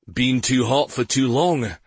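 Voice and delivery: talking round sausage; mouth full. A narrator's reading. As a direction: speak through a mouthful. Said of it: "around a mouthful of sausage".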